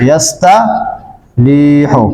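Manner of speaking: ইয়াস্তারিহু drawn out slowly and low; slow demo. Shown as a demonstration. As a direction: draw out ইয়াস্তারিহু for the class. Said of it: "যেমন দেখেন".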